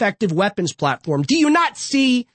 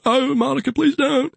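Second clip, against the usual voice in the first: a hint of a husky Clinton plea; playful take on the former president begging; Bill Clinton impression; 3 semitones higher than usual. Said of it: "I'll do the voice for a second".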